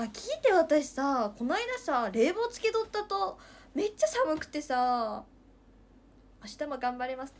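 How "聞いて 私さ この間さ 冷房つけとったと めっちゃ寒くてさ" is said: excited telling. Real, not acted. Excited story start.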